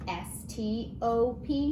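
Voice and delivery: usual speaking voice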